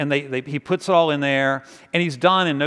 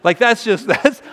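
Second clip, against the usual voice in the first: loud indignant preacher voice; rally the congregation against the theft; fired-up sermon, raised voice; preaching at full force; 3 semitones higher, 4 dB louder than usual. Acted.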